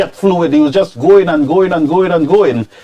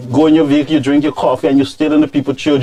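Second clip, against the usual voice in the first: ordinary delivery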